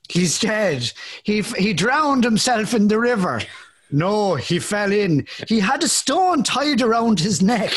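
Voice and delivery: hammed-up Irish melodrama, drawn-out words; trailer Irish accent. Host acting out the movie trailer, exaggerated Irish accent. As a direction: stretch it out in a mock Irish brogue. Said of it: "the trailer of this movie".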